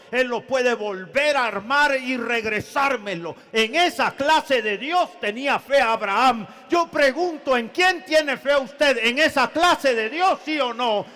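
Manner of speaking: loud, high-pitched impassioned sermon; preaching at full force. Real, not acted. Preacher challenging the congregation about Abraham's faith, at full force. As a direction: build to a ringing, full-voiced appeal.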